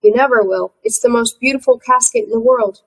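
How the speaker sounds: plain reading voice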